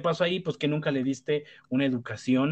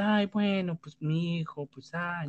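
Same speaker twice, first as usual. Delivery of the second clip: higher, sighing mock parent giving up; resigned parent; 5 semitones higher, slower than usual. An indulgent parent excusing her child, sighing and resigned. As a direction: shrug it off like an indulgent mom. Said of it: "Y dices".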